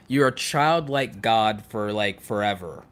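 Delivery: ordinary delivery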